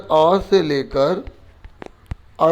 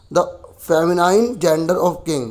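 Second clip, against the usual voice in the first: dictation read; much slower than usual. Shown as a demonstration. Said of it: "teacher reads exercise question".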